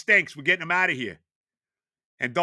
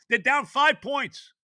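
ordinary delivery

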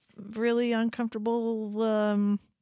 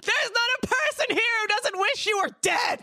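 high, drawn-out acted scream; hammed-up furious shriek; angry yell; slower than usual. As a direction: scream it at a hated coworker, full voice. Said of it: "wanted to yell at someone I work with".

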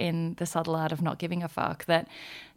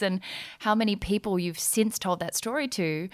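ordinary talk, nothing marked

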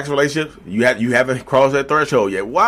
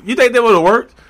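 incredulous; 11 semitones higher, 5 dB louder than usual. Real, not acted. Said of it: "rhetorical question to viewers".

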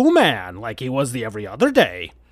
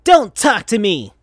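higher, louder angry rebuff; dark angry snap; 7 semitones higher, 5 dB louder than usual. Acted. Sonic brushing off Shadow, angry and loud. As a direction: cut him off coldly. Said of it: "in a voice darker than Shadow".

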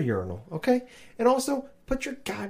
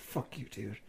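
much softer, low under-the-breath fuck you; grumbling quietly at the guy who smiled over; muttered curse; 4 semitones lower, 9 dB quieter than usual. Retold or imitated. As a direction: mutter it so the smiling guy barely hears. Muttering at smiling urinal neighbour.

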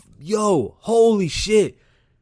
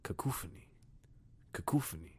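whispered word; 8 semitones lower, 14 dB quieter than usual. Shown as a demonstration. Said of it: "When you say it".

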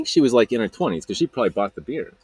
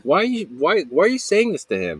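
high-pitched imitation of a protesting friend; indignant defence; 8 semitones higher, 3 dB louder than usual. Friend challenges stepsister for putting him down, indignant. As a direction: protest on a friend's behalf, voice jumping up. Friend objects to sister's put-down.

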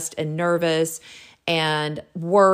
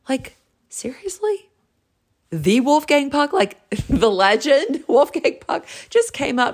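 high, incredulous squeal with laughter; starstruck disbelief; 9 semitones higher, 3 dB louder than usual. Retold or imitated. Woman learns the chef was Wolfgang Puck, starstruck disbelief. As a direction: marvel at the famous name, pitched way up.

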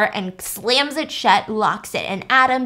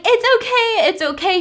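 much higher airy reassurance; high breezy woman; 11 semitones higher, 4 dB louder than usual. Retold or imitated. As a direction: brush off a fall sweetly. Fallen woman brushes it off.

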